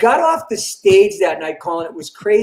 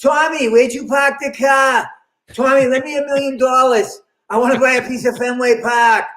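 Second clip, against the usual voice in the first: put-on higher-pitched Boston accent caricature; 3 semitones higher than usual. Boston guy hitting up his pal Tommy, broad Boston accent. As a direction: call out to Tommy in broad Boston, dropping R's. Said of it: "We're more like".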